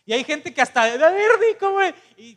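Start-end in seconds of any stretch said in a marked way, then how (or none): 0.8-1.9 much higher, louder coaxing; egging parent mock. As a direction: coax the child like a proud parent showing off. Parent urging child to swear. (padre que anima al niño a decir groserías, en voz alta y aguda)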